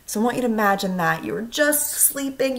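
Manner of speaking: plain reading voice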